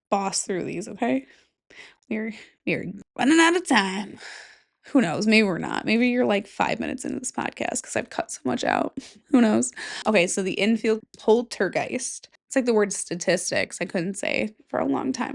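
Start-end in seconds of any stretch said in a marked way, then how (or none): 6.4-9.0 low whisper to listeners; whispered aside. Real, not acted. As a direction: confide the editing trick.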